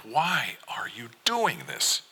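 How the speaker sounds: slow, drawn-out, pained question; exasperated. A narrator's reading. Father asks why his son is doing this, exasperated. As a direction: stretch the words in helpless frustration. Father despairs over son's refusal.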